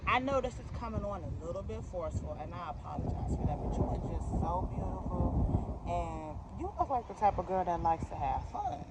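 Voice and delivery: hushed imitation of a coy customer; soft coaxing. Customer apologizes for being forceful, softly coaxing the fitter. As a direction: flirt quietly, easing into the proposition.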